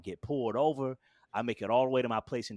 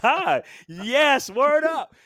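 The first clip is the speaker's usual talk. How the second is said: overjoyed shout; 13 semitones higher, 11 dB louder, much slower than usual. Retold or imitated. Overjoyed shout at express train.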